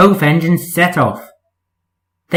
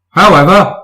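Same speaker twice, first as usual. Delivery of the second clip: emphatic; 4 semitones higher, 6 dB louder than usual. Acted. Fat Controller relenting.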